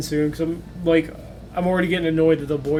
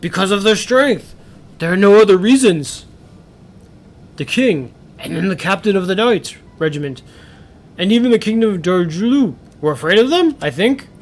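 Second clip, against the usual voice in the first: father character; 4 dB louder than usual. Acted.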